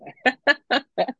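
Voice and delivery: high-pitched giggle; short laugh at your own remark. Real, not acted. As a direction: burst into a high chuckle.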